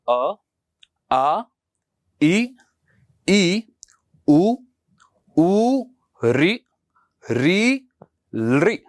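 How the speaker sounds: each vowel drawn out, rising; teacher recites the vowel chart; slow clear vowel recital for pupils; reciting vowels. Shown as a demonstration. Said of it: "Sanskrit की वर्णमाला जो हमारे पास है".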